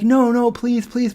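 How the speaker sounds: put-on high, pleading child's whine; whiny begging. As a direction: beg like a desperate kid. Begging mom for the spikes.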